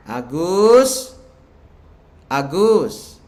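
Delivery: loud, drawn-out rising call, then falling. Acted. God calls Agus by name, calling out loudly.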